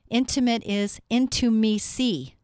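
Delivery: INTIMACY stretched slowly into separate beats, loud; slow, punched-out wordplay on intimacy; drawn-out syllables. Shown as a demonstration. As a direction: break intimacy apart as in-to-me-see. Teaching wordplay, splitting intimacy.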